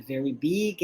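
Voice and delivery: ordinary talk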